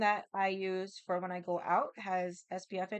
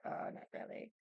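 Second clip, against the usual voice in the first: whispered shrug; 9 dB quieter than usual. Retold or imitated. Shrugging off other liquor.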